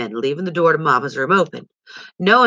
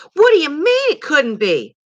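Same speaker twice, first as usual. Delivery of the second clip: higher, louder, alarmed; a frightened boy echoing back in panic; panicked cry; 11 semitones higher, 4 dB louder than usual. A narrator's reading. Little brother panicking at his brother's doubt, higher and louder. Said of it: "cried Christopher John in a panic".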